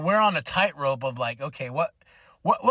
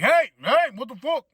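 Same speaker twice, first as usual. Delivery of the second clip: louder, higher put-on protest; mock objection; 5 semitones higher, 5 dB louder than usual. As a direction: call out in pretend offence. Acting out someone objecting.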